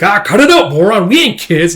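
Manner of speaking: annoyed outburst at a splashing friend; irritated retort. Retold or imitated. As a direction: shove the teasing off hard. Guts snapping during bath teasing.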